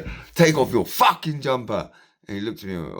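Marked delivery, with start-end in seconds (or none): 0.3-1.9 1 angry demand. Retold or imitated. Retold confrontation.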